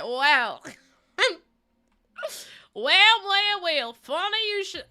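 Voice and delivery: loud, drawn-out southern "well"; high, stretched-out southern greeting; southern accent. Pretend. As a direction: put on a playful southern accent. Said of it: "speaking with a southern accent".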